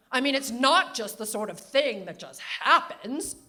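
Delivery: put-on loud, brisk New England accent. Grandmother lecturing over cheese, loud New England accent. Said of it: "New England Katharine Hepburn accent".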